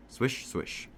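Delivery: hushed, whispered swish of fabric; soft swishing noise. A narrator's reading. Narrator mimics phone sound from a pocket, soft swishing. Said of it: "I hear a swish swish sound".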